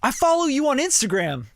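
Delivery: bright eager stranger recognizing him; excited fan. Retold or imitated. A young fan at a restaurant calls out to him, eagerly. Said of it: "He's like".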